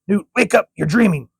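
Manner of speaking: high, loud, urgent call to wake her. Acted. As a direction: shake her out of the nightmare. Waking a screaming girl.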